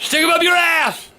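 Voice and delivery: cranky grandpa yelling at his son; angry shout. Real, not acted. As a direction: bark it at him in disgust.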